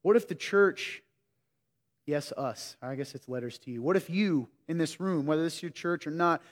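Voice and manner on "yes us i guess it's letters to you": ordinary talk, nothing marked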